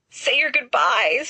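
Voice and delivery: slow, drawn-out moan. A narrator's reading. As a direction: groan it out like a doomed man. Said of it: "Sirius moans".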